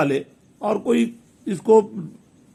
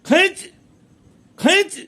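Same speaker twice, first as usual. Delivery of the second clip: shouting up to be pulled out, getting higher; calling out louder and louder; 7 semitones higher than usual. Acted. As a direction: pitch climbing much higher on each खींच. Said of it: "फिर बाद में धीरे जोर जोर से पुकारने लगा".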